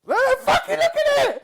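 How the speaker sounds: laughing, very high shout; exasperated shriek. Real, not acted. Exasperated argument about tongue.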